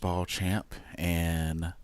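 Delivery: low, slow sultry radio voice; slow it down and make it sultry; sexy radio voice. Pretend. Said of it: "Sexy voice".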